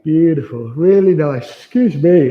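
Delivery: yawning mid-word. Real, not acted. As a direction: talk through a yawn. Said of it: "I'm yawning".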